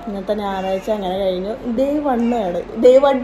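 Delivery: usual speaking voice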